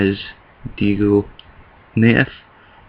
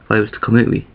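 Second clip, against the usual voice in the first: normal talking voice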